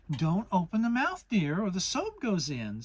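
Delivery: lightly voiced brisk mother; busy mother scrubbing away; Kanga voice. A narrator's reading. Kanga warning Piglet during the bath, motherly.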